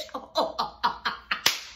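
soft unvoiced giggle; breathy laugh. Real, not acted. Host reacts to the mother line with a quiet laugh.

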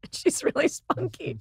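slow and high through a laugh. Real, not acted. Telling the hosts about her friend, laughing. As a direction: chuckle it out fondly.

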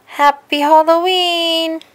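high, loud, long-held last syllable; celebratory cheer. A narrator's reading. Reader ending the Halloween party story for children, jubilant cheer.

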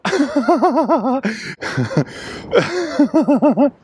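long high-pitched laughing fit; uncontrollable laughter. Real, not acted. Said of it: "I can't stop laughing".

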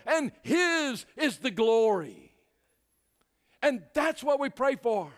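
high, loud, emphatic sermon delivery; impassioned preacher at the climax; full-force preaching. Real, not acted. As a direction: drive each phrase home from the pulpit.

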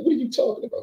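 put-on high, squeaky naive young man; Billy's voice. Billy squeaks confused at the Philly brothers. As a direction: pitch it way up like a clueless kid.

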